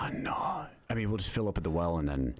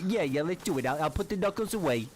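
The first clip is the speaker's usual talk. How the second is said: tough-guy street taunt, pitched up; swaggering street thug voice; cocky nervous street slang; 12 semitones higher than usual. Acted. Tough-guy roleplay with stranger.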